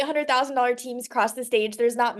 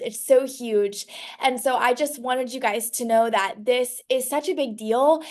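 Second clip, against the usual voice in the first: ordinary talk, nothing marked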